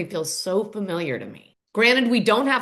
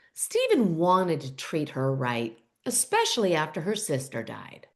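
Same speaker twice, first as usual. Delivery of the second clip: slow, low retold mother's voice; Mama McBee; 5 semitones lower, 4 dB quieter than usual. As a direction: weigh in slowly with the voice dropped. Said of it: "Mama McBee pipes up again".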